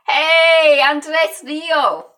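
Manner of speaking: loud, high, stretched hello; real high-pitched shout-out; calling out greeting. Real, not acted. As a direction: call out happily to an arriving viewer. Greets a live viewer by name.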